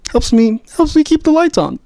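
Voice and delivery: high squeaky voice. Shown as a demonstration. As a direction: jump up into a squeaky voice. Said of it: "repeated phrase, rising to squeak".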